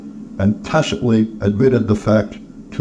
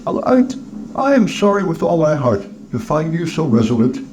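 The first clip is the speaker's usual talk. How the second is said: higher-voiced, earnest nephew; nephew, regretful; 6 semitones higher than usual. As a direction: open haltingly, pitched high, then settle. Nephew's voice in the story.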